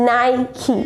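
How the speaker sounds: Nike as 'nai-kee', two syllables; correct pronunciation. Shown as a demonstration. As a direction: model Nike for repeating, ending 'kee'. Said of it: "Repeat after me". (Nikeનો સાચો ઉચ્ચાર, 'નાઇકી')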